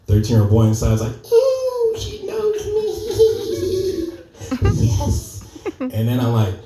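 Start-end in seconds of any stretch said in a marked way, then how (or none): 1.3-5.4 much higher squeal of delight; giddy teen boy. Retold or imitated. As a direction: squeal like a giddy thirteen-year-old. Thirteen-year-old boy inside reacts.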